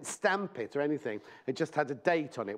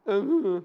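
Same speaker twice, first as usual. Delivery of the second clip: short laugh; 5 semitones higher, 7 dB louder than usual. Real, not acted. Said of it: "That's the noise [name] just made".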